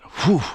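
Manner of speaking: a sharp, very high, loud whoo of relief; thrilled whistling exhale; high loud whoop. Real, not acted. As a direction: blow it out like you survived the run. After hitting 130 again.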